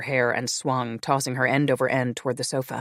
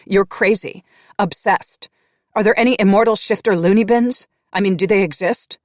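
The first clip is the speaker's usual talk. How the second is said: loud, high, accusing tirade; furious rant; 7 semitones higher, 10 dB louder than usual. Acted. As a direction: hurl it all at him.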